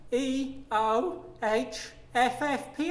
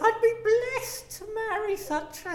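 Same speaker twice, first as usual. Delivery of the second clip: flirty woman voice; 10 semitones higher than usual. Acted. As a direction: greet them like a coquettish lady.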